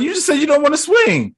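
high-pitched comic complaint; mock protest. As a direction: imitate a whiny objection up high. Acting Spider-Man protesting.

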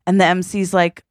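ordinary talk

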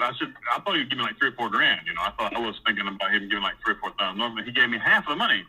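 normal talking voice

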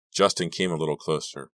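usual speaking voice

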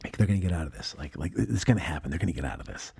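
whispered, hushed hope; hopeful whisper. Retold or imitated. Audience member whispering hope in the theater, hushed. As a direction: hush it like a hopeful viewer in the dark. Audience hoping they'll escape.

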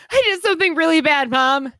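put-on high, fast, frantic child's wail; panicky kid voice. Mother imitating her son's panicked confession, high.

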